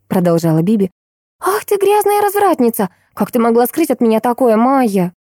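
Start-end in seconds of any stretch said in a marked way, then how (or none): 1.4-5.1 mock scolding. A narrator's reading. Friend teasing over a hidden boyfriend, mock scolding. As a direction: start high, scandalised in jest.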